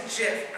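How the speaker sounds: gif said as "jiff"; wrong soft G. Retold or imitated. Comic retelling what girlfriend said, gif pronounced "jiff". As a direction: start gif with a J sound. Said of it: "when she finally said gif".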